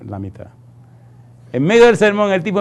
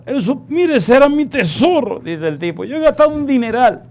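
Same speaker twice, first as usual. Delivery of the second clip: whiny high voice; 10 semitones higher than usual. Retold or imitated. Young man mourns his lost treasure.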